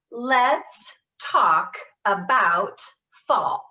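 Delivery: slow, loud, every word given equal weight; every word stressed. Shown as a demonstration. Therapist demonstrating to the group, every word stressed. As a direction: hit each word with intention. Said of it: "go with me".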